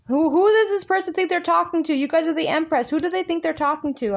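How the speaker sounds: indignant. Acted. Tarot reader outraged on the Virgos' behalf at their partner, indignant.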